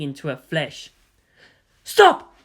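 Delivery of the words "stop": much louder and higher, one sharp cry; shout. A narrator's reading. Karu begging Martin to let her go, a loud high shout. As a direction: yell to stop the killing. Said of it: "Karu shouted".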